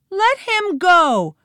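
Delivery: high, loud demand; demanding cry. A narrator's reading. Girl to the villain holding the boy, loud high demand.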